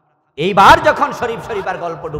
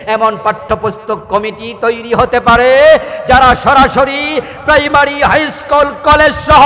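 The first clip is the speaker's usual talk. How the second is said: loud, high-pitched rally sermon; full-force preaching; 11 semitones higher, 12 dB louder than usual. Real, not acted. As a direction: build into a ringing shout to the crowd. (জোর গলায় উত্তেজিত ওয়াজ)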